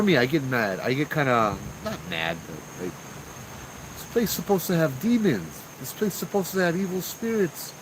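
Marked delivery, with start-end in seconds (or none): 3.9-7.7 higher, half-joking grumble at the ghosts; irritated mock complaint. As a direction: grumble like you're let down by the haunting. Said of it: "I get a little irritated".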